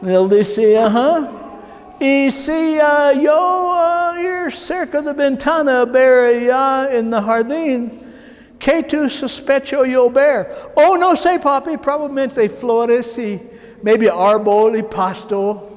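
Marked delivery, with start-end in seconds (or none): 0.8-10.5 knowing father. Retold or imitated. Father tests his lying son, mock-sly raised voice. 10.8-15.7 put-on high falsetto boy feigning ignorance, slowing at the end; innocent son. Son pretends to know nothing, high innocent voice.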